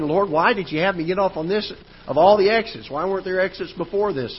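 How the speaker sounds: a driver's exasperated prayer on an empty road; frustrated prayer. Retold or imitated. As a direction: complain to the Lord, fed up and tired. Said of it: "I'm getting mad at God almost".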